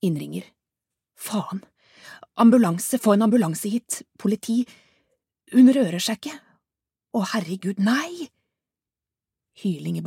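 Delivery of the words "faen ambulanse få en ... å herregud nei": louder, breathless and rising to a higher cry for help; frantic woman calling emergency services; panicked caller. Acted. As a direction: beg for help in terror. Caller panicking on 911 call.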